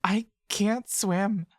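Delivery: put-on a scared girl confessing she can't swim; whimpering. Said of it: "whimpers Maya Lee".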